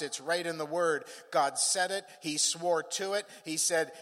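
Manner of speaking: driving preaching in even, insistent phrases. Real, not acted. Preacher assuring the congregation God doesn't change, driving emphatic preaching. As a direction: proclaim it in a steady, emphatic roll.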